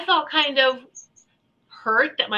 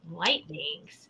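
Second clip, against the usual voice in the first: "Light beings?" echoed lower and much softer, puzzled; incredulous mom; 7 semitones lower, 12 dB quieter than usual. Retold or imitated. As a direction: repeat "Light beings?" low and quiet, as if the words make no sense. Mom questioning the strange term.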